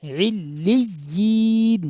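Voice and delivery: ইল্লিয়িন chanted slowly, with the double l held and no long vowel; slow chanted tajweed model; Quran recitation. Shown as a demonstration. Teacher models the word.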